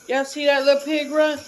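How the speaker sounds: high and loud, pointing it out; excited. Real, not acted. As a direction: call out delighted to the viewers. Excited call to viewers.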